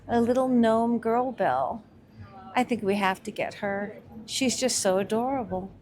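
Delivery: ordinary talk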